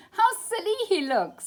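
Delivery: loud, high teasing jeer; mocking lamb. A narrator's reading. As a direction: laugh at him like a cheeky lamb.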